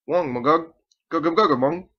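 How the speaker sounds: garbled, high and loud through a mask; muffled through gas mask. Acted. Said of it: "Fritz chuckled in his mask, pulled it off, boom".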